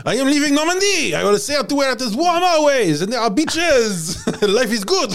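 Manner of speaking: high, exuberant cartoon Frenchman; mock French accent. Norman knight declaring he will sail away, mock French accent. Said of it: "Raymond said".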